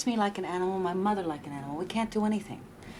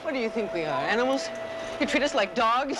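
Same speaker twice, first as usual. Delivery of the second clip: angry protest; 3 semitones higher than usual. Acted. Maria confronts the boss.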